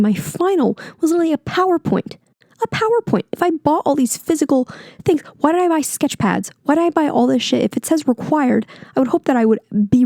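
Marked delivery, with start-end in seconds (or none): 2.6-7.6 higher-pitched indignant venting; getting heated. Real, not acted. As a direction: protest in heated, exasperated disbelief. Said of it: "I'm sorry I'm getting so heated".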